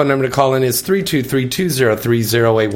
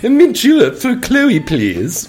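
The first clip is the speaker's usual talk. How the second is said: posh lady voice; 10 semitones higher, 3 dB louder, slower than usual. Pretend. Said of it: "I'm Missus Howell over here".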